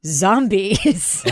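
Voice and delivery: Keith Morrison. Acted. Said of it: "where he says".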